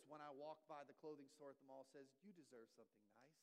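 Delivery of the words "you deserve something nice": tempting inner voice. Pretend. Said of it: "My voice ... says".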